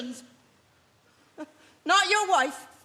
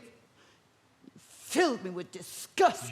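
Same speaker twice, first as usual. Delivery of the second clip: disgust; 8 semitones lower, 5 dB quieter, much slower than usual. Acted. Wife confesses revulsion.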